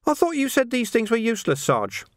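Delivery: high-pitched, complaining lad; puzzled junior constable, voiced high; young recruit's voice. A narrator's reading. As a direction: pitch it well up, questioning the sergeant. Young recruit questioning sergeant in read story.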